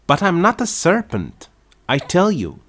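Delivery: insist, exasperated; indignant protest. A narrator's reading. Alice insisting to the pigeon, exasperated high protest.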